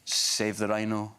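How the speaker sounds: slow, half-hearted campaign slogan; costumed rhino campaigner. Retold or imitated. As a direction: drone the slogan like a costumed charity worker. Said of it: "it was this person going".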